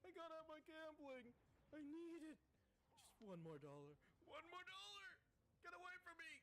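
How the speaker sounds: mostly high, whiny mock plea; pleading gambler. Player voices the game character who is gambling, mock pleading. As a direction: beg in a put-on desperate voice. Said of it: "He's like".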